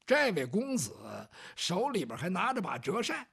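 ordinary delivery

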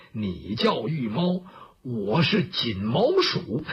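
low, slow, drawn-out taunt; menacing challenger. Acted. Bai Yutang faces the Imperial Cat on the roof, low menace. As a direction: size up your rival slowly, voice dropped low.

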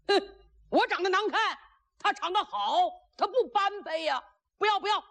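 high-pitched balking refusal; stubborn protest. Acted. Groom balking at too pretty a bride, high stubborn protest. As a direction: refuse it in a high, quick fluster. Niu Gao balks at the match.

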